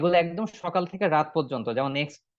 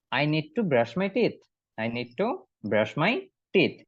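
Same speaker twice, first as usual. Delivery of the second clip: "I need to brush my teeth" slowly, breaking into chunks; slow model reading; much slower than usual. Shown as a demonstration. Teacher drills the line in slow chunks. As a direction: split the sentence into slow pieces. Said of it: "ওইটাও ইংরেজিতে বলবেন".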